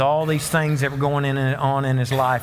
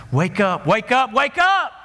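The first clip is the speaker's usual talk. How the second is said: rising call; 7 semitones higher than usual. Retold or imitated. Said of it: "God's voice was getting louder".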